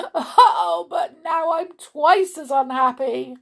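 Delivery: high, loud theatrical lament; wailing. A narrator's reading. King complains he is doubly miserable, wailing. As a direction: sob the oh, then wail on. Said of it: "he wailed".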